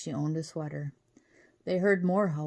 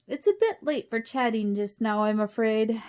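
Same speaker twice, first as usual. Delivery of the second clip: loud, higher British refusal; calling out politely; 4 semitones higher, 5 dB louder than usual. A narrator's reading. Professor turns away wolves at the door, calling.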